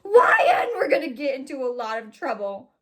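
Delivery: loud, high hammed-up wail; alarmed outcry. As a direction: cry out in overblown alarm. Alarmed Ron in acted dialogue.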